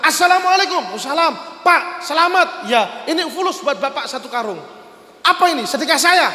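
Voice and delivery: high, animated back-and-forth dialogue; excited acted greeting. Acted excited greeting, handing over money. (sapaan heboh bernada tinggi)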